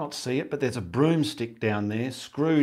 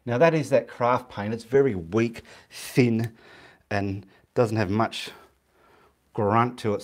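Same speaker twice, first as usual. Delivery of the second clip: ordinary delivery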